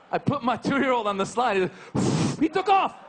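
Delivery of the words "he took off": 1 amazed. Retold or imitated. Son shooting down slide.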